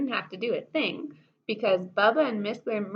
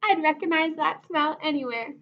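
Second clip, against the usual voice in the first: excited; 5 semitones higher, 4 dB louder than usual. A narrator's reading. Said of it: "shouted Miss Lurleen".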